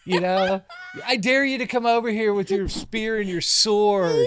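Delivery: high, loud taunting giant; mock a giant's challenge; Goliath taunting. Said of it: "He's like".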